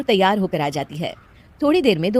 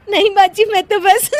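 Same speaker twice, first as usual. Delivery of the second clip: high, giggly plea breaking off; excuse lost in laughter; 4 dB louder than usual. Acted. Daughter-in-law answering mother-in-law, laughing helplessly. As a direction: begin explaining, collapse laughing. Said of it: "तू फिर से हंस दी।".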